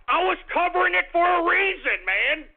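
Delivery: loud, high-pitched insistence; exasperated outburst. Real, not acted. Host defending his coverage to doubters, exasperated outburst.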